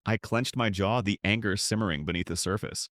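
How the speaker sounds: ordinary delivery, nothing marked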